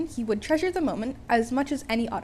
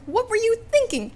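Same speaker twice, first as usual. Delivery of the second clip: scolding; 11 semitones higher, 5 dB louder than usual. Acted. Hero scolds boy on roof.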